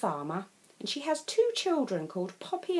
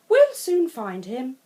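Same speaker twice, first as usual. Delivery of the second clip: slow, louder reassurance; reassuring farmer; 5 semitones higher, 9 dB louder than usual. Acted. Farmer reassuring the children they will find Curly, slow and steady. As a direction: soothe the worried kids.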